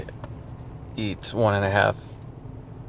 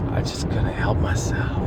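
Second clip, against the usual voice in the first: guilty near-whisper; 4 dB quieter than usual. Real, not acted. Confessing giving in to pizza.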